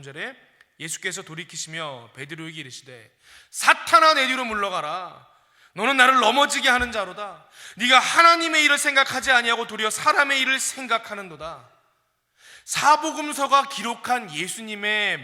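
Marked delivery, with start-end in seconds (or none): 3.5-11.7 stern rebuke. Acted. Jesus rebuking Peter, loud and stern. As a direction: rebuke him sharply and loudly.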